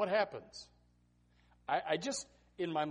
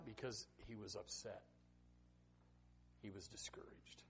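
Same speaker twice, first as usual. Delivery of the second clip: whispered, much softer; hushed; 9 semitones lower, 21 dB quieter than usual. Acted. Preacher confiding Moses's discouragement to the church, whispered. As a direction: drop to a whisper for the sad truth. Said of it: "sermon hush".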